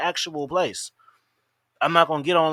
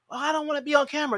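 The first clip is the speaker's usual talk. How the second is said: quick, higher whiny excuse; hammed-up girl's excuse, higher and fast; mock whiny girl; 8 semitones higher, faster than usual. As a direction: toss it off like a reluctant girl. Mimics reluctant girl's excuse.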